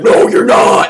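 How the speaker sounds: loud mock demon voice; put-on possessed voice; devil voice. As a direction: contradict him as the devil, loudly. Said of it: "the devil voice comes in".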